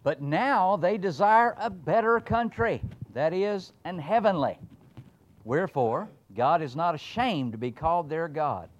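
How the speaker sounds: loud, high preaching read of the verse; scripture reading. Acted. Preacher reading Hebrews to the congregation, ringing out.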